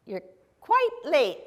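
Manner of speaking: high, hammed-up lordly reproof; mock-impatient lord of the manor. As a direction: play an impatient lord scolding a servant. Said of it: "I am the impatient lord".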